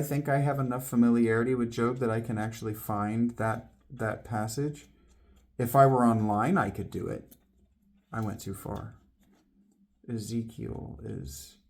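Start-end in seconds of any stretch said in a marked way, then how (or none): none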